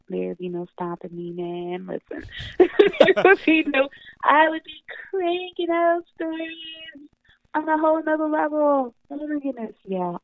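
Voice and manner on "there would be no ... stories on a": laughing through the words, a bit slower; giddy laugh breaking into the line. Real, not acted. Author laughing with podcast host about writing horror, laughing.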